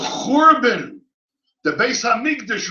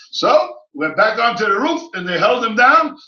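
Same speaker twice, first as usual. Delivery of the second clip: normal talking voice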